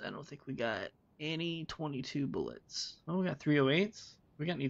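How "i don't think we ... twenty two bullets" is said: ordinary talk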